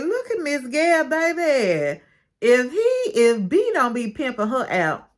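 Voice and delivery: high-pitched, animated exclamation; hyped amazement. Real, not acted. Gossiping to listeners about Miss Gail's boldness, hyped amazement. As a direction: crow it like juicy gossip.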